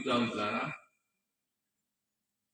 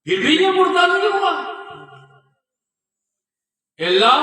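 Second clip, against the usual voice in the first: loud, higher crying out to Allah; loud, higher pleading vow; anguished prayer; 8 semitones higher, 8 dB louder than usual. Retold or imitated. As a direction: cry out to God in despair. Saint pleading to God.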